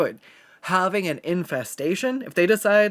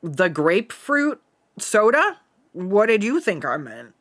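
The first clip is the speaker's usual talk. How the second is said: louder, higher, slow "The grapefruit soda?" then indignant; mock exasperated reply; 4 semitones higher, 5 dB louder than usual. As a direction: retell it with mock indignation, slowly. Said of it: "And I'm like".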